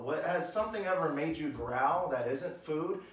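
ordinary delivery

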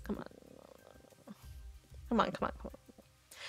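whispered impatient urging; impatient whispered plea. Real, not acted. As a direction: hiss it under your breath. Impatient under her breath.

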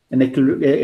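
usual speaking voice

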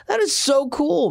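high, slow retold enthusiasm; delighted approval. Man reacting to the kid's church news, delighted. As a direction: stretch it out high, thrilled for him.

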